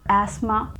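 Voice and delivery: asthma said as "ASS-ma", with S for Z; British pronunciation. Shown as a demonstration. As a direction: keep the Z out of asthma. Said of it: "There is no Z sound here".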